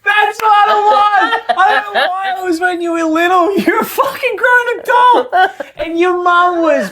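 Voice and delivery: laughing so hard the words come out shrieked. Real, not acted. Teasing her friend about the lemonade story, shouting through laughter.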